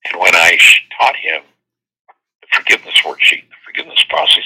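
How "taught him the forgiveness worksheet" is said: ordinary delivery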